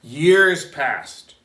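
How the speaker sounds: usual speaking voice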